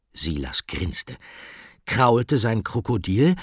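usual speaking voice

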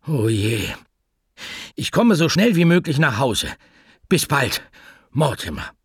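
anxious pet shop owner talking to the answering machine; Mortimer the pet shop owner, nervous. Acted. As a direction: rush the message, worried and flustered.

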